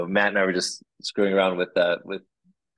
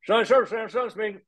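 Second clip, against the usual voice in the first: much higher, mumbled phone greeting; mumbling Sonny; 14 semitones higher, faster than usual. Retold or imitated. Mechanic Sonny picking up the shop phone, high mumbled greeting. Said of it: "he'd say".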